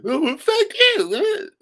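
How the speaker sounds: very high, loud, drawn-out mock screech; mocking imitation. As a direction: mimic an offended relative in falsetto. Mimics drunk sister's angry retort.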